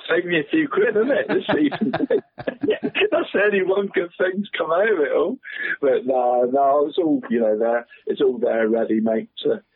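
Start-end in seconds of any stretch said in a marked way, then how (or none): 0.1-5.4 laughing. Real, not acted. Laughing about saved money.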